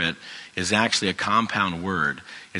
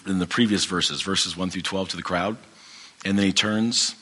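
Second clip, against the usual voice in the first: ordinary talk, nothing marked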